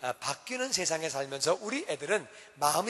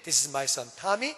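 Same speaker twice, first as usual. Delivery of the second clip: polite introduction; 5 semitones lower, much slower than usual. Retold or imitated. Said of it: "애들도 분명히 악수시키고 인사시킵니다".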